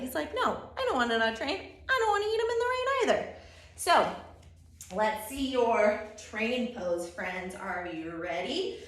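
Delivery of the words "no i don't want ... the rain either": raised, quickening defiant protest. Acted. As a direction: act out a stubborn refusal, speeding up. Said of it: "he's very defiant".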